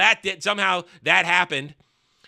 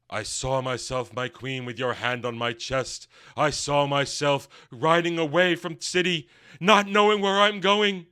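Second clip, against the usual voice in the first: lowered hero voice; 4 semitones lower than usual. Acted. Retelling a character's vision speech.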